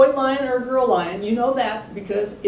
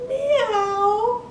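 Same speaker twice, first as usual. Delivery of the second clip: very high little kitten meow; tiny meow; 13 semitones higher than usual. A narrator's reading. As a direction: squeak out a small kitty meow. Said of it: "all that came out was a little".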